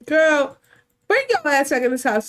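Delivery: calling her back. Acted. Mom yelling at daughter to come back, loud. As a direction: yell her back into the house.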